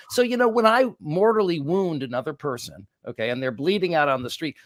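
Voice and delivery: usual speaking voice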